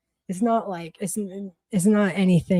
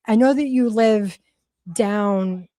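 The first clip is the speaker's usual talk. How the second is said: ordinary talk, nothing marked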